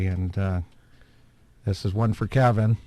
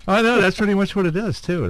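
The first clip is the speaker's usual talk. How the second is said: high, laughing agreement; 9 semitones higher, 4 dB louder than usual. Real, not acted. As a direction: agree while still cracking up.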